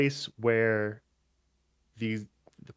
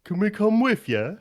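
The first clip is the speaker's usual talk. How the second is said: high, loud pleading ogre; 11 semitones higher, 6 dB louder than usual. Acted. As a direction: beg like a hopeful ogre. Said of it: "Trounce the ogre goes".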